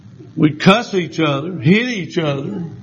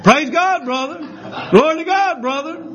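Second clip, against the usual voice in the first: much higher, hammed-up church praises; mimicking enthusiastic coworkers; 9 semitones higher than usual. Preacher imitating coworkers shouting praises, high and hammed-up. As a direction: sing out each praise like an eager churchgoer. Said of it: "all I hear is".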